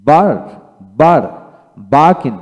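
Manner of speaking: usual speaking voice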